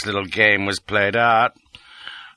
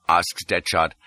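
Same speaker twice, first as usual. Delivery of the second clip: ordinary delivery, nothing marked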